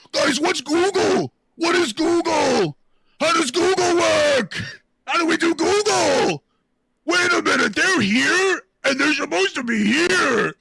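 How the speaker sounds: dopey mock of the fact-checking team asking about Google; mock clueless voice. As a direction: play dumb like a lost fact-checker. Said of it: "mocking clueless team".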